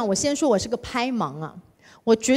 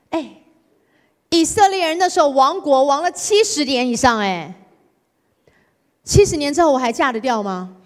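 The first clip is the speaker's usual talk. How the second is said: ordinary talk, nothing marked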